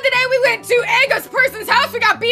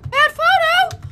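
higher, incredulous question; startled, raised-pitch query; disbelief; 6 semitones higher, much slower than usual. Real, not acted. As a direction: repeat it like you can't believe it. Repeats it in disbelief.

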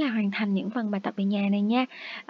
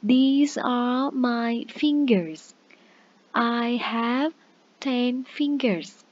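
put-on very slow, each word spaced out for children; slow, clear teacher demo; slow model sentence; much slower than usual. Shown as a demonstration. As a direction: model it slowly for young learners. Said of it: "thì mình nói sao?".

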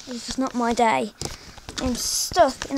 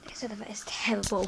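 whispering; 2 semitones lower, 8 dB quieter, faster than usual. Real, not acted. Muttering frustration in elevator.

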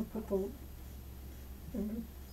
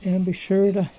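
loud sign-off; 9 dB louder than usual. Real, not acted. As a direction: call it out to everyone.

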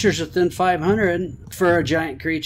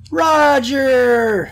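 loud, high, stretched-out holler; calling out; 7 semitones higher, 6 dB louder than usual. Real, not acted. Player calling the bad luck sheep, long loud shout. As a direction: yell for him, drawing out the name.

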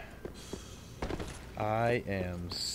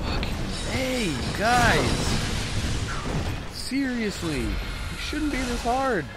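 high-pitched fed-up whine; exasperated whine; 10 semitones higher, 3 dB louder, slower than usual. Real, not acted. Gamer complaining to the enemies that it's too hard, high whine.

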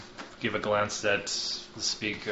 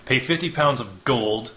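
threatening envoy; 6 dB louder than usual. Acted. Envoy Hawthorne threatening the speaker with invasion, cold and loud. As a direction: lay down the threat coldly.

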